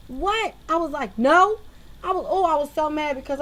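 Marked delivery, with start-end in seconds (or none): 1.2-1.6 a loud, higher, indignant "No."; loud offended retort; sharp no. Retold or imitated. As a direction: snap back a loud, offended no. Snapping back at the lady.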